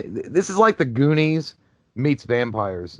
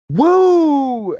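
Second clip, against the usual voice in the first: mock pained whoop; 14 semitones higher, 7 dB louder than usual. Sunlight hits hungover face.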